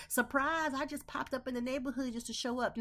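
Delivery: high, bright surprise visitor; mock pop-up guest. Friend showing up unannounced, high cheery surprise. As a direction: play the uninvited friend at the door. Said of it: "What you cannot do is".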